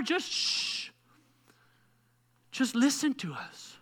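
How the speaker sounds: soft whispered shh; hushing whisper. Retold or imitated. Parent hushing daughter Nola from outside the door. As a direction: hush the child gently.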